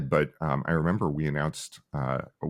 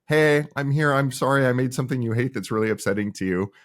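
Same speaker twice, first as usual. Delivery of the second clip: eager friendly greeting; 7 semitones higher, 4 dB louder than usual. Retold or imitated. Founder greeting angry commenters.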